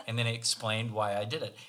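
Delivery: normal talking voice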